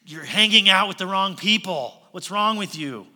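jeering. Acted. Religious leaders scolding the disciples for their company, loud jeer. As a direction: throw it at them as an accusation. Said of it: "that jeer in my voice".

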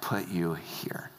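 low, slow reassurance; deep, unhurried affirmation; affirming. Acted. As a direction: settle him with a low, measured statement. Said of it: "he starts to affirm King David".

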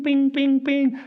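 high, loud mimicked ping of metal; imitated pings. Retelling the freeway crash, imitating the car's pinging sound. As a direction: ring out each ping like a car striking metal. Car hitting guardrail, mimicked.

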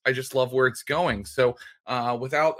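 usual speaking voice

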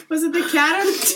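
asked through laughter; laughing. Real, not acted. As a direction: laugh while you ask. Dog just farted, laughing.

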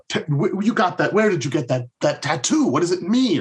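high, eager mock questions; curious high quoting. Lost asking Shane about the belly tattoo, eager and high. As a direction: pitch up to sound like the nosy stranger.

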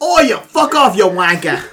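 laughing Cockney insult; mock Cockney. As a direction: put on a cheeky Cockney accent. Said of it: "a hundred percent Cockney on it".

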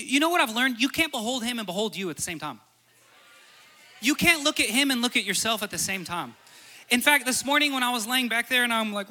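impassioned sermon climax; fervent preaching. Acted. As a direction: press the point with rising fervor. Preaching at full force.

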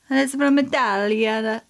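put-on slow Italian-accented Italia. Vlogger telling where the wine is from, in an Italian accent. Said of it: "speaking in an Italian accent".

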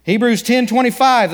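usual speaking voice

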